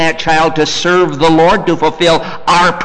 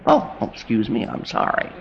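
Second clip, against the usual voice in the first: softer, whispered sorry; meek apology; 6 semitones lower, 10 dB quieter than usual. Retold or imitated. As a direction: shrink back apologetically. Parent apologizes meekly.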